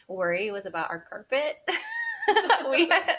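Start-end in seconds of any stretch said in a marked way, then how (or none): 1.6-2.7 high, loud burst of laughter; big laugh. Real, not acted. Telling the host about the carpet worry, bursting into laughter.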